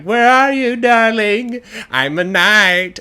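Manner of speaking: high, loud singsong call; loud, drawn-out, triumphant call. Acted. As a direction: holler for your sweetheart across a castle. Sailor calls through castle for her.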